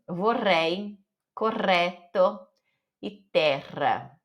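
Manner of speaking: "Vorrei, correto, terra" with a long trilled double R; rolled double R. Shown as a demonstration. Teacher demonstrates double R to learners, long rolled R. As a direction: hold the roll on the RR of "terra". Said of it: "tem aquele enrolar da língua".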